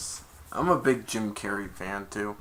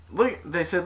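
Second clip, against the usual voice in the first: normal talking voice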